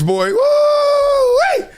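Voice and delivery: long, high drawn-out whoop. Real, not acted. Host amused by his critics, high whooping. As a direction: let out a slow high whoop.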